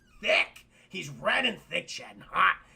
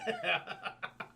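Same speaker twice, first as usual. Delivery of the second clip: breathy burst of laughing; real laughter; 4 dB quieter than usual. Real, not acted. Streamer ends the retelling, real laughter. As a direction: crack up at your own bit.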